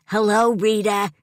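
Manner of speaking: put-on high, loud old lady greeting; shouting greeting. A narrator's reading. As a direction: call out to someone hard of hearing. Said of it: "Missus Antonelli shouts".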